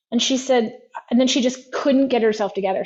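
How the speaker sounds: ordinary talk, nothing marked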